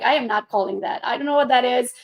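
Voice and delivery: ordinary talk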